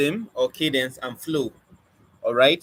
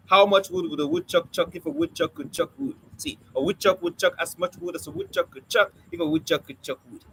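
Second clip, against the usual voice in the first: rattled off quickly with crisp consonants; rapid, rhythmic tongue-twister delivery; fast tongue twister; 2 semitones higher than usual. Shown as a demonstration. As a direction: race through it, hitting every stop. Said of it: "you say them fast".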